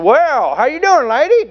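put-on high Southern teen charmer; Dan Parchman. Teen greeting a waitress, high imitated Southern voice. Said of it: "He's just talking like this".

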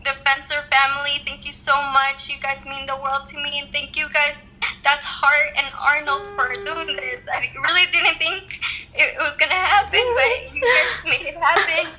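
teary voice over a phone call; choked up, thanking through tears; crying on the phone. Real, not acted. [name] thanking them over FaceTime.

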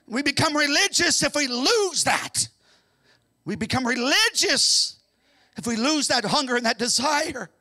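fervent sermon crescendo; rising preaching. Acted. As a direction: build the preaching back up. Preaching building up again.

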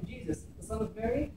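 ordinary talk

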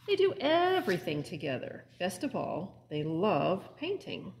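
lively, expressive storybook read-aloud; animated picture-book narration; reading to children. A narrator's reading. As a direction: read it like story time for little ones. Librarian reads picture book aloud.

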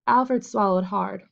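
ordinary delivery, nothing marked

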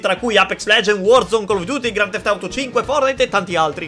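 soft, steady sponsor read. Acted. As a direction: read it like a quick sponsored spot.

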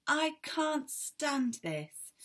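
Mister Bear at his wits' end, high and slow; exasperated wail. Acted. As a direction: stretch it out in despair. Mister Bear kept awake again.

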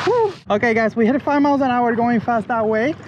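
ordinary delivery

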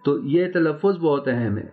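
usual speaking voice

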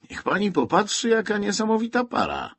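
enthusiastic exclaim. A narrator's reading. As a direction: urge her to look, thrilled. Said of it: "wykrzyknął z entuzjazmem Walkiewicz". (reporter woła do Melanii z entuzjazmem)

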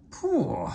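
high squeaky phew; high disgusted "phew"; exclaiming phew. A narrator's reading. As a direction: wrinkle the nose at a smell. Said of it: "remarked Thomas".

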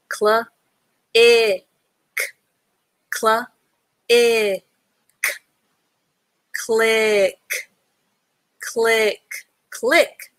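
"Cl-" and "ick" said apart, then joined as "click"; sounding out click. Shown as a demonstration. Teacher sounding out click for the class. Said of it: "C K has the K sound".

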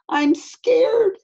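put-on scared child. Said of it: "he said".

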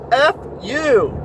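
put-on high, loud child's scream; kid screaming. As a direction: yell it like a furious four-year-old. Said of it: "this little four-year-old kid starts screaming".

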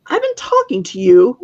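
an indignant nymph calling out, a touch shrill; a hint of a shrill, offended nymph. A narrator's reading. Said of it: "shrilled the nymph".

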